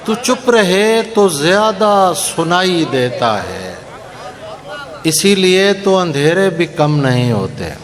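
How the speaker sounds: slow, measured mushaira recitation; poetry recitation. Acted. Poet reciting ghazal to a mushaira audience, measured declamation. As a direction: recite the couplets, drawing out key words.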